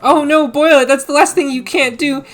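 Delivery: high, loud mock panic; alarmed pleading. Horton begs the judge to spare the who's, mock-frantic. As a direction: plead urgently, hammed-up. Acts pleading against the boiling.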